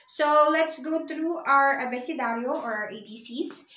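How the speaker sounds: normal talking voice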